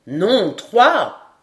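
loud, forceful correction; emphatic contradiction. A narrator's reading. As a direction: contradict him loudly. Glenarvan contradicts the sergeant.

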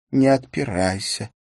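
ordinary delivery

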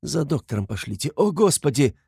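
high-pitched anguished appeal; distressed pleading. A narrator's reading. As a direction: plead for help, rising on the exclamation. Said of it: "Металась она ко всем". (в отчаянии просит о помощи)

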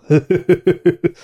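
magas, szapora kuncogás; színlelt nevetés. A narrator's reading. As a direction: kuncogj szereplőként. Felolvasott szereplő nevetése. (magas hangú nevetgélés)

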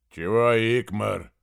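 slow, hoarse and booming giant; hoarse bass. A narrator's reading. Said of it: "пробасил осипшим голосом великан".

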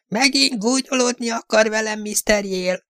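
high, thin little fortune-teller; a wary little man squeaking at a mocking young gent; thin, squeaky voice. A narrator's reading. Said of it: "vékony, sipító hangon".

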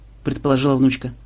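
usual speaking voice

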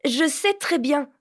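put-on bitter, indignant girl; sour retort. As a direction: snap back, stung. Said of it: "cria Vanka aigrement".

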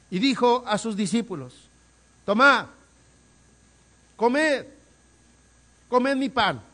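high, loud, slow sermon delivery; fervent preaching. Acted. Preacher to congregation about the bread, fervent loud preaching. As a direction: proclaim each phrase with force.

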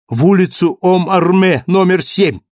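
high, loud call to the driver; shouted order. Acted. Marius shouting the address to the cab driver, loud. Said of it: "закричал Мариус".

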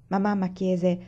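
ordinary delivery, nothing marked

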